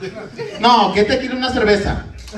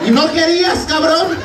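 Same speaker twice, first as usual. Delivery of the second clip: teasing shout; 7 semitones higher than usual. Real, not acted. Teasing volunteer after drinking.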